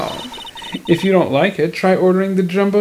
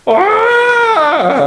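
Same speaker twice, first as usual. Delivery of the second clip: stifled scream; 13 semitones higher than usual. Real, not acted. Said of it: "I can't scream".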